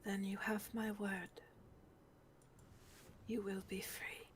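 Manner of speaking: hushed, tender vow; solemn whispered promise. Acted. Moira vows freedom to the scared girl, tender whispered promise. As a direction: promise it gently, almost whispering.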